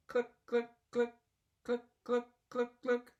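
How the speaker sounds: high, clucking hen. A narrator's reading.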